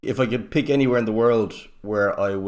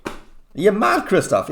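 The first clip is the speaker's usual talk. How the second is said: imitating a friend, much higher and louder, incredulous; mock disbelief; 11 semitones higher, 6 dB louder than usual. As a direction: squeal it like a mate who can't believe his ears. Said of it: "one of them was stopping his leg going".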